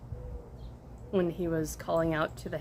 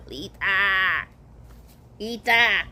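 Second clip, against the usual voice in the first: mock caveman call; 5 dB louder, slower than usual. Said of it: "So he go".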